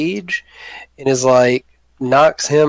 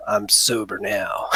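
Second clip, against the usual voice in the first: softer, slow and sober; quiet declaration; 4 semitones lower, 7 dB quieter, slower than usual. Acted. Reformed drunk tells the goblin, softly and slowly. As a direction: say it low and deliberate. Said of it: "looks at him and says".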